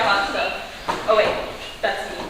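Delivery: normal talking voice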